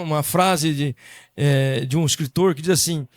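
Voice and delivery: ordinary delivery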